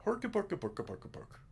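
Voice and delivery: high, fast mock Swedish gibberish; Swedish Chef. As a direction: babble like a cartoon Swedish cook. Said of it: "the Swedish chef from the Muppets".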